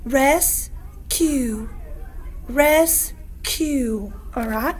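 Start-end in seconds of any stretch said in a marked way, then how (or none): 0.1-1.7 "Rescue" broken into res, pause, cue; slow "Rescue" with a clear gap between syllables. Shown as a demonstration. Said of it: "Let's pound our syllables". 2.5-4.1 "Rescue" split as res, pause, cue; slow, separated "Rescue", each half given its own beat; syllable by syllable. Shown as a demonstration. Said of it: "Let's pound our syllables".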